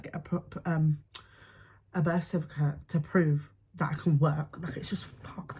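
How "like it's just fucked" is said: hushed despair. Real, not acted. Despair over the situation.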